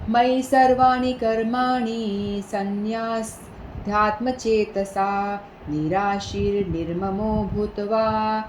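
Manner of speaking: slow, measured chanting of a scripture verse; slow devotional recitation; Sanskrit verse recitation. Acted. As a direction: intone the shloka slowly and reverently.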